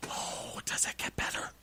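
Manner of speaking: hushed, conspiratorial whisper; teasing whisper. Real, not acted. Teasing listeners that the night gets worse, whispered. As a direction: whisper it with relish. Teasing the next part of story.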